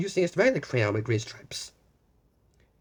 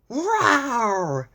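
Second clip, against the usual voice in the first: higher, louder playful dragon roar; 7 semitones higher, 5 dB louder than usual. A narrator's reading. Dragon greeting Blue, playful roar. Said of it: "the dragon growled".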